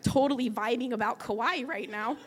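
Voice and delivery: normal talking voice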